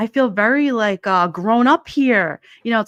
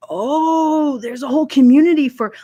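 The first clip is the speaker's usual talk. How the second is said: pitched up, louder amazed realization; surprised discovery; 5 semitones higher, 5 dB louder than usual. Retold or imitated. Recalling finding ASMR online, loudly amazed. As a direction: sound like you just stumbled on it.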